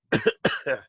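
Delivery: real cough from water down the wrong pipe; choking cough after a sip; coughing fit. Real, not acted. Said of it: "Drank water and it went down the wrong pipe".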